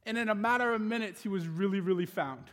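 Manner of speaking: full preacher's cadence; preaching. Acted. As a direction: preach it to the congregation with conviction. Preaching the sermon point.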